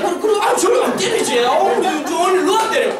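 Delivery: high, eager reassurance; over-sweet, high-pitched coaxing; mock appeasing. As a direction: placate a sulky child, hammed up. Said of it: "그럼 정말 달래야 돼요".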